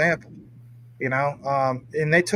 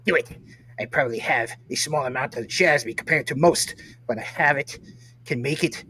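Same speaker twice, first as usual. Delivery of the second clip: lightly hammed-up evil emperor, a bit quieter; Darth Sidious voice; 3 semitones lower, 4 dB quieter than usual. Host reading a viewer comment, mock Sith lord voice. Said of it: "let's read it in that voice".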